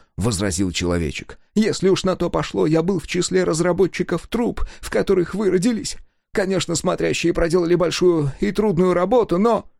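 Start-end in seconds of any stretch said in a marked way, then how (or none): 1.5-9.7 high, eager little man's voice; excited old engineer. A narrator's reading. Engineer gloating at Sega, high and eager. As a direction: crow it out high and thrilled.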